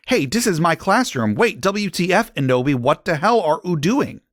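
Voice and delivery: high, indignant outburst. Acted. Sinister finding Enobi in her classroom, indignant. Teacher confronts intruder in classroom.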